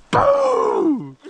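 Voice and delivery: a high, punchy imitated boom; high mock gunshot in the retelling. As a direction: shout the gun sound. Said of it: "and you're like".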